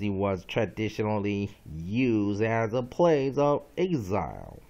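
slow, loud, measured definition read-out; dictionary reading. Acted. Teacher reading a vocabulary entry to students, slow dictionary reading. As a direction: read it out emphatically, phrase by phrase. Reads dictionary entries aloud.

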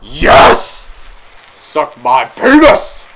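loud, gloating victory yell; triumphant shout. Real, not acted. Player finally clearing the level, triumphant yell. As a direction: crow over a hard-won win.

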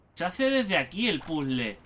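usual speaking voice

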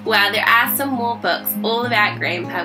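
ordinary delivery

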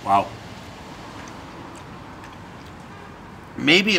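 talking while chewing; slight muffled between bites; mouth full. Real, not acted. Seen 1: bit slice just before, jaw working while talking at 0-3.8.

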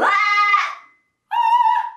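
very high, loud fry squawk; vocal fry scratch. Shown as a demonstration. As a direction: squeeze out a rattly squawk.

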